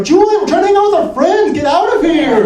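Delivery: high, loud exasperated yell; annoyed friend. Retold or imitated. Friend telling Julian to leave them alone, high loud yell. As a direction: shoo him off, high and loud. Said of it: "They'll be like".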